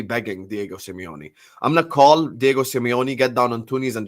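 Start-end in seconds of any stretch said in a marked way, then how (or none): none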